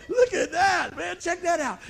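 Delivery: loud high thrilled gawking; mock boyish excitement; excited kids. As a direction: call out like an amazed kid. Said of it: "and we're going".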